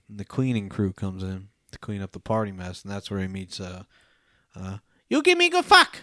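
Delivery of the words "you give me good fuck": much higher and louder broken English, hammed up; imitating the Vietnamese girl. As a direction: blurt it out like her in a mock high voice. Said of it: "she literally says that".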